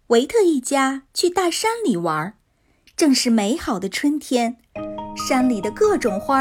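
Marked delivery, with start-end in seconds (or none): none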